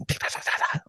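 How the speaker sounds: quick, soft tat-tat-tat-tat; imitated spanking noise. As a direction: mouth a rapid run of smacks. Imitates hand spanking rapidly.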